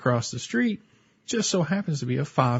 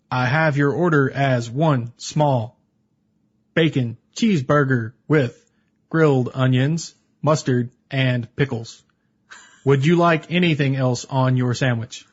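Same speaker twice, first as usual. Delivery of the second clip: slow, stone-faced formal readback; deadpan robotic cashier, slowed right down; stiff cashier impression; slower than usual. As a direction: read the order back like a machine, slowly. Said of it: "I will give my best impression".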